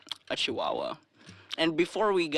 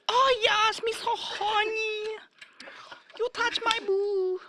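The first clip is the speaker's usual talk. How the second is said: very high, loud, slow caricature voice; 4 dB louder, slower than usual. Retold or imitated. Teller mimics the lady again, high and drawn out. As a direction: squeal it out as the lady.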